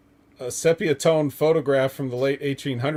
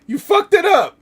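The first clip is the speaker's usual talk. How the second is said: much higher and louder, near a scream; shrieking yell; 9 dB louder than usual. Retold or imitated. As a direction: lose it completely on the repeat. Repeating a quoted angry line.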